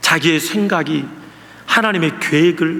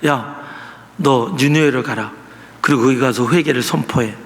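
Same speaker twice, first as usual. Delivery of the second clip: God commanding; 7 semitones lower than usual. Retold or imitated. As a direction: lower commanding voice. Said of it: "하나님이 요나에게 이야기하죠".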